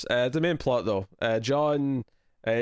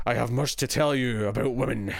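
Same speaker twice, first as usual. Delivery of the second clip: a light Scottish Worf impression, grave and measured; Worf voice, Scottish-tinged. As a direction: deliver it like a stern Klingon mentor with a hint of Scottish brogue. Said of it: "I go even more Scottish when I'm doing a Worf voice".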